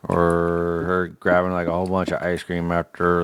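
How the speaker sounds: normal talking voice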